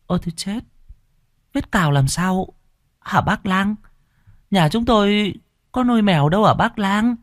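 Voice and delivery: frightened mother questions the healer, panicking; startled and alarmed. Acted. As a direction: pitch shoots up in fright. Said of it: "giật mình kinh hãi".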